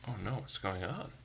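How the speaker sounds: usual speaking voice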